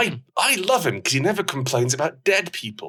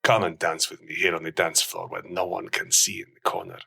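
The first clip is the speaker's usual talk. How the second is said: put-on Spanish accent; 6 semitones lower than usual. [name] woos Sarah on the dance floor in a low Spanish accent. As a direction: purr in a low Spanish accent to charm her. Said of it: "I'm going to do a traditional Spanish accent".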